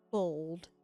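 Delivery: ordinary talk, nothing marked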